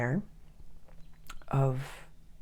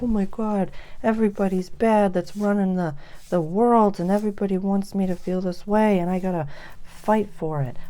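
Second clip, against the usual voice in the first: higher and louder, worked-up imitation of someone stuck; mock dramatic rant; 4 semitones higher, 5 dB louder than usual. Imitating a paranoid awakened person, a louder, higher rant. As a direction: ham up the outrage. Acted quote of a stuck mindset.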